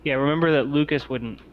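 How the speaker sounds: normal talking voice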